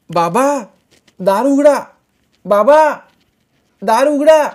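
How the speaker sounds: put-on high, loud child's calling out; girls calling. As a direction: call through the locked door. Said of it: "मुलींना हाका मारायला लावल्या".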